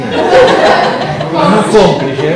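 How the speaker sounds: drawn out loud laughing word. Real, not acted. Host joking about an accomplice, drawn out and laughing. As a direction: stretch it out through a laugh.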